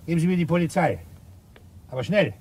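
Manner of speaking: mock urgent phone request; urgent demand. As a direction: play the frantic caller. Acting out the witness's call.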